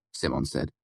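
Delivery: ordinary delivery, nothing marked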